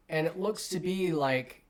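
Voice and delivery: ordinary talk, nothing marked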